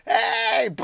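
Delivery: high, loud answering yell; shouted hey back. Retold or imitated. Friend yelling hey back across the street. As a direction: holler it back even bigger.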